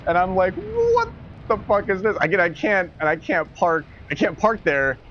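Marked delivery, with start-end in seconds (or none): 0.5-2.2 incredulous outburst. Retold or imitated. Truck blocking his door.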